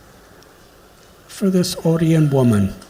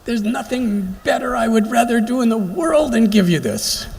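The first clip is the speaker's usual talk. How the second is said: higher-pitched, hammed-up delight in serving; eager to serve; 4 semitones higher than usual. Airline hostess making a passenger feel gladly served, mock eager. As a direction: gush as if this were your greatest pleasure. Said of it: "supposed to make you think there's actually".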